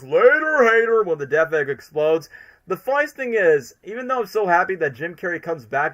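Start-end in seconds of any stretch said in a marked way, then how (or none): 0.0-1.0 high loud villain taunt; Eggman quote. Retold or imitated. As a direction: gloat it like a cartoon villain. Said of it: "where he goes".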